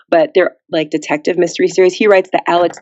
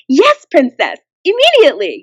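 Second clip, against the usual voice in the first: put-on much higher, bright young assistant; chirpy eager young helper, pitched way up; perky assistant; 8 semitones higher than usual. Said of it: "a very perky assistant".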